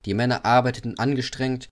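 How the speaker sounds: usual speaking voice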